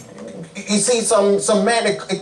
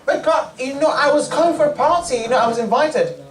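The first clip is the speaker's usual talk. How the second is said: higher, pleading excuse to God; 6 semitones higher than usual. Acted. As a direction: whine the excuse up high like a caught kid.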